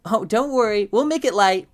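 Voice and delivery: put-on high, loud teasing child voice; mischievous twins. A narrator's reading. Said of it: "You can hear them both laughing".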